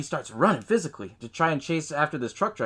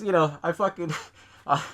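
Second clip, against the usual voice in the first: normal talking voice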